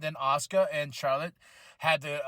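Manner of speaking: ordinary talk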